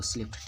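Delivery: स्लिप्ड ending in a voiceless T; correct past ending. Shown as a demonstration. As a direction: say स्लिप्ड as "slipt". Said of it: "पढ़ते हैं इसको". (स्लिप्ड के अंत में ट की आवाज़)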